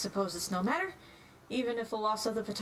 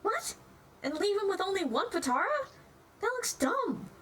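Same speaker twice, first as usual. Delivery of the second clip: much higher, disbelieving; incredulous; 9 semitones higher than usual. Acted. Hours questioning Days about the Potara, much higher. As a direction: react in disbelief.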